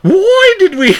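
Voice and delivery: squeaky hammed-up British accent. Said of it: "Why'd you turn British for a second?".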